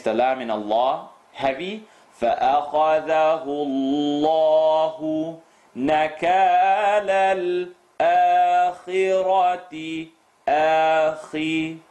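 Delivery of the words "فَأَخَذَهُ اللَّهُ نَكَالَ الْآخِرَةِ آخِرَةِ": melodic chanted recitation; Quran recitation. Shown as a demonstration. Teacher recites Quran verses.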